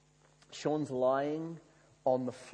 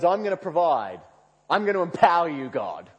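loud, high, mock-heroic proclamation; grandiose promise; 5 semitones higher, 10 dB louder than usual. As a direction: declare it like God's great benefactor. Mocking a grand promise to God.

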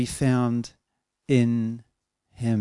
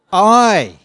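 a loud, higher, drawn-out I; stress on I; 7 semitones higher, 10 dB louder than usual. Shown as a demonstration. Answering where the emphasis was, stressed I. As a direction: blare out the "I" all on its own. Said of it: "Where was the emphasis?".

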